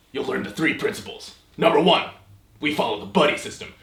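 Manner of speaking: usual speaking voice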